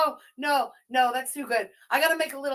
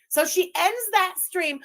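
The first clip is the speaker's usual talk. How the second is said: ordinary talk, nothing marked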